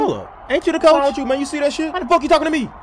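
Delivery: ordinary talk, nothing marked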